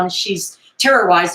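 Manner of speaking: ordinary talk, nothing marked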